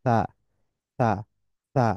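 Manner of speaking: th-like sa drill. Shown as a demonstration. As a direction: breathe সা out gently. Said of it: "আমার সাথে practice করতে পারেন".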